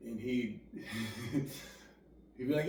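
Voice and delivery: usual speaking voice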